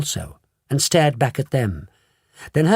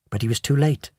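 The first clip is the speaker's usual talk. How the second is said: ordinary delivery, nothing marked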